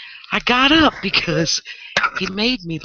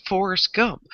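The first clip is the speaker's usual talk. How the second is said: usual speaking voice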